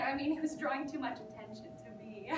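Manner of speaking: ordinary talk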